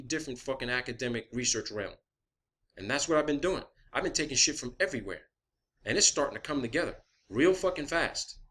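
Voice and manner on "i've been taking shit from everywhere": usual speaking voice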